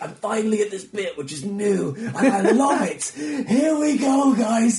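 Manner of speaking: high, loud, slow and beaming with a laugh; hammed-up thrill of reaching the new bit; gleeful comic onstage. Comic to a cold crowd, overjoyed at the new bit. Comedian voicing own excited self on stage.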